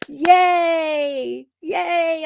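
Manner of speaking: high joyful yay; happy cheer. Real, not acted. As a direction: cheer for her delight. Celebrating friend's new crosses.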